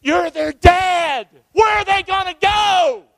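high, loud, drawn-out cry; impassioned preaching shout. Real, not acted. Preacher confronting dads whose children are scared, impassioned shout. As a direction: shout it as an urgent challenge. Preacher pleads with fathers at full force.

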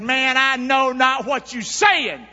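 slow, loud, high denial; Peter denying. Acted. Peter denying the accuser, slow and loud. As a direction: deny it slowly and hard.

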